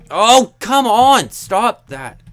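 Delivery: loud, high, drawn-out angry shout; frustrated yell. Real, not acted. Gamer yelling at a mob attacking him, furious shout. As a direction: yell at the game in real frustration.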